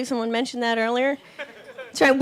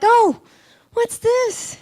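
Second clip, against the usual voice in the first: higher, startled oh what's this; mock surprise; 10 semitones higher, 3 dB louder, much slower than usual. Finding a hair in the rearview mirror, higher surprised voice. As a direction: discover the stray hair with a gasp. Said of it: "I'm like".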